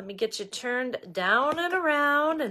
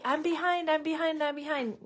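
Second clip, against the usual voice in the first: anxious fretting; 6 semitones higher, faster than usual. Retold or imitated. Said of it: "and people will say".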